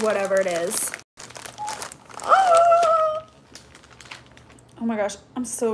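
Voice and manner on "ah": high, sharp scream of delight; excited scream. Real, not acted. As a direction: let out a happy shriek. Unwrapping soft gift.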